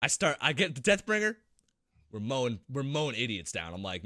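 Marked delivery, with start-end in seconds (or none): none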